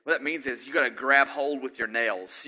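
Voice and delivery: usual speaking voice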